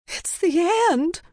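very high despairing wail; high-pitched doomsday shriek; panicked cry. A narrator's reading. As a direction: cry out that all is lost. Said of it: "another woman cried".

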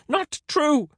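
very high scream of denial. A narrator's reading. Caesar refusing Render's insult, high scream. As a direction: shriek it, pitched right up. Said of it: "screamed Caesar".

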